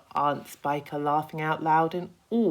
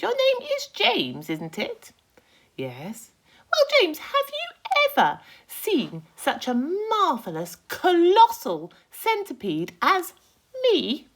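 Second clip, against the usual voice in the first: much higher, louder cocky insect voice; a boastful little bug, pitched way up; Centipede voice; 9 dB louder than usual. Acted.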